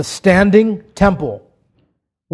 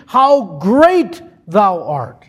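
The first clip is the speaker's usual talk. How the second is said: slow, drawn-out proclamation, high on GREAT; exultant declamation; 5 semitones higher, much slower than usual. Acted. Preacher crowning the hymn quotation for the congregation, exultant. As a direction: land the hymn line with grand, measured weight.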